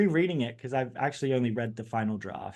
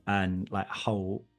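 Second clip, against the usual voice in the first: British accent; 2 semitones lower than usual. Shown as a demonstration. Interviewee recalls grandmother's attic tea on request, British accent. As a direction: put on your best British accent, understated and unhurried. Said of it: "answer the question with your best British accent".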